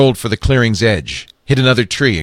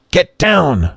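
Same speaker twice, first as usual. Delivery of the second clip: raised, higher urgent warning; urgent call; 10 semitones higher than usual. A narrator's reading. Lee Daniel warns into the mic.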